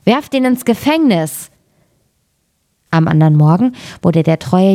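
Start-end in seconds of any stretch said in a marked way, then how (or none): 0.0-1.5 angry call. A narrator's reading. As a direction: order the guards in anger, without shouting. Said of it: "wurde zornig darüber und rief".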